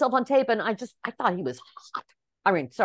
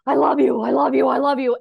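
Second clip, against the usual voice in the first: high, loud, frantic I love you's; hysterical love cries; 5 semitones higher, 7 dB louder than usual. Retold or imitated. Actress in audition throwing herself at the director, hammed-up hysterical. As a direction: gush it out like a woman losing it with love. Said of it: "she did the scene where she was like".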